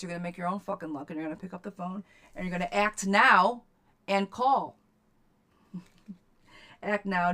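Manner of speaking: rally her to call; shouting encouragement; fired-up pep talk. Real, not acted. Pep-talk build to climax.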